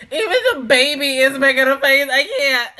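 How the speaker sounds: loud laughter through the words; laughing so hard you can't speak; cartoon laugh. Real, not acted. Laughing at the baby's face.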